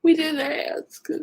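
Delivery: high, slow, tearful near-whisper; in tears. Real, not acted. Hurt streamer saying nobody asked to come here, in tears. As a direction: squeeze the words out through real tears. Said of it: "I'm in tears thinking about it".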